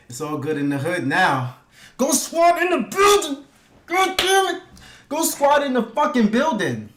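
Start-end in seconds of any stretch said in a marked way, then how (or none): 2.0-6.9 high, excited crowd-hyping holler; hype shout. Real, not acted. Streamer hyping the Go Squad, high excited holler. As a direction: call it out high like a hype man.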